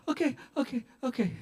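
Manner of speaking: high, soft panicky whimper; whimpering surrender. Acted. Driver threatened with broken arm, whimpering surrender. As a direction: give in quickly, in pain.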